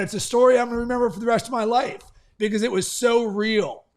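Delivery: ordinary talk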